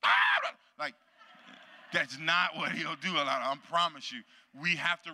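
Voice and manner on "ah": mock sudden outburst. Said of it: "you're gonna be like".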